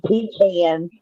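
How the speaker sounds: "pecan" with a long ee and a flat a as in can; pee-can pronunciation. Shown as a demonstration. Southern Indiana woman retelling a word test, "pecan" as PEE-can. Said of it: "they asked me to say".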